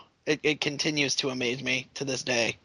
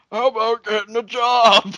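mocking old politician; 7 semitones higher, 7 dB louder than usual.

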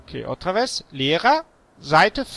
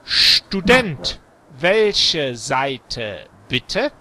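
slow model reading; much slower than usual. Shown as a demonstration. Teacher models for student to repeat, slow deliberate delivery. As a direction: spell it out by ear, word by word.

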